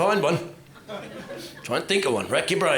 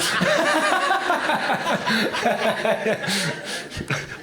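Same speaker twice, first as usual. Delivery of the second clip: shrieking laugh, much higher; high squealing laugh; 12 semitones higher than usual. Real, not acted. Comic cracking up at his own patronising line. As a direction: let a high cackle burst out.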